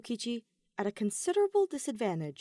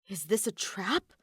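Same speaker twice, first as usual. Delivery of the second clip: worried. A narrator's reading. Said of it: "K one B zero worried".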